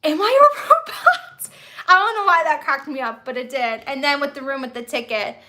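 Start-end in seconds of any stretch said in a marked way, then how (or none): 0.0-1.5 high squeaky laughing question; giggling while imitating the line; laughing quote. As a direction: laugh through the quote, pitched way up. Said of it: "and he's like".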